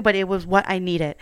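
ordinary talk, nothing marked

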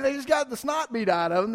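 ordinary delivery

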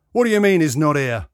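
loud, irate question; indignant demand. A narrator's reading. Patient told appointment is cancelled, indignant demand. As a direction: demand it across the counter. Angry patient confronts receptionist.

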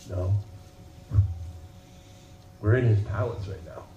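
low, soft sermon close; reflective preaching. Acted. Preacher closing the message softly over music. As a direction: draw them in quietly.

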